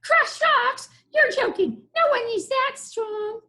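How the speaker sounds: high, squeaky mocking goblin; laughing goblin. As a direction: scoff at the idea like a cocky goblin. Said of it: "The goblin laughed".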